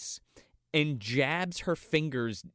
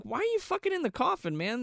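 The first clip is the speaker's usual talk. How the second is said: much higher exasperated question; incredulous outburst; 12 semitones higher than usual. Retold or imitated. Viewer yells at the couple in the coffin, incredulous. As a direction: toss it up in disbelief.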